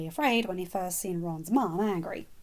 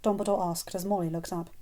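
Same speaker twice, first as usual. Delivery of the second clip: usual speaking voice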